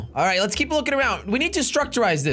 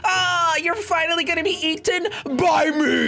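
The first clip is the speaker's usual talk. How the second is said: gleeful; 7 semitones higher than usual. Pretend. Gloating at ice cream.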